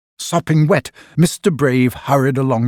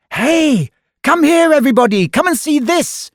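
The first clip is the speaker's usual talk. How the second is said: high, loud, fast call to the crowd; mischief-maker calling the crowd at top of her voice; shouting; 14 semitones higher, 4 dB louder than usual. A narrator's reading. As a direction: yell for everybody to come and look. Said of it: "she shouted at the top of her voice".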